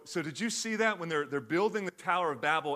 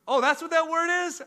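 much higher, surprised realization; mock surprise; 13 semitones higher, 9 dB louder than usual. Kevin Hart realizing his mistake, high surprised tone. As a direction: act surprised at the word. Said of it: "He goes".

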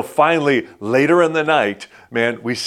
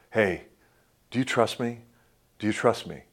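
gentle, hushed question; 8 dB quieter than usual. Retold or imitated. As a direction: ask it quietly, twice, like a gentle plea. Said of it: "what God was saying".

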